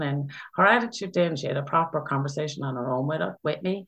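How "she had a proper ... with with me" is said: normal talking voice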